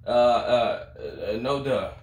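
ordinary talk

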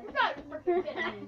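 loud, incredulous protest; exasperated disbelief. Real, not acted. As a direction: throw up your hands at the twist. Reacting to the story's twist.

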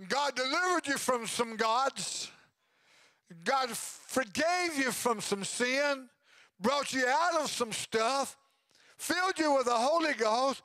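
slow, lifted preaching build; preaching cadence. Acted. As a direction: lay each line down slowly like a preacher.

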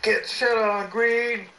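high, drawn-out taunting yell; gloating shout. Real, not acted. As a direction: crow over a beaten opponent, high and stretched. Taunting opponent in game.

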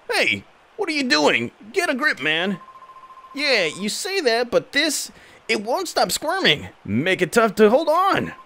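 squeaky comic henchman bickering; goon voices. Acted. Voicing goon characters in dialogue.

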